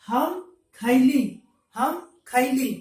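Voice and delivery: हम खैली said slowly and loudly, each syllable clear; slow clear demo. Shown as a demonstration. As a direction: spell out हम खैली slowly, like a teacher. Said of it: "मैंने का हम होता है यहाँ पर हम खैली". (धीरे और ज़ोर से साफ़ उच्चारण)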